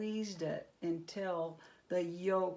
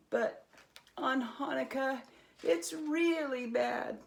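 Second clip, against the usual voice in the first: raised, rising complaint about the goblins; aggrieved villager; 5 semitones higher, 7 dB louder than usual. A narrator's reading. Villager telling Herschel about the goblins, aggrieved. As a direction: pour out the village's misery.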